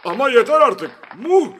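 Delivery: high, loud, fed-up outburst; angry. A narrator's reading. Prime minister has had enough of applause, angry. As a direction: explode at the clapping crowd. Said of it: "Başbakan kızmıştı".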